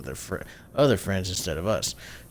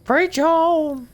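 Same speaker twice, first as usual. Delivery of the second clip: shouted cheer; 7 dB louder than usual. Acted. Cheering friend's eulogy line.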